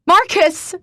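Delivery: high, loud desperate call; crying out. A narrator's reading. Chasing Marcus out into the rain, a loud call. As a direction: call after him as he leaves. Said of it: "I cry".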